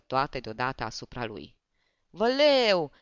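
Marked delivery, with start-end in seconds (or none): 2.1-2.9 a high, loud cry of fright; terrified cry. A narrator's reading. The terrified comic cries out at the barber. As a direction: cry out in sudden terror. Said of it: "strigă el".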